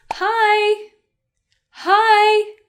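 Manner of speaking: high, loud, held-out hello; friendly greeting. Shown as a demonstration. Teacher modelling an informal greeting for friends, high and loud.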